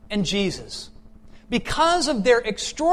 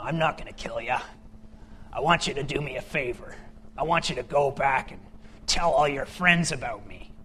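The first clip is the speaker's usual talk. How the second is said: low, husky superhero voice, admittedly rough attempt; Batman; 6 semitones lower, 4 dB quieter than usual. Batman gives the caught thug orders, low put-on hero voice. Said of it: "can't really do the Batman voice".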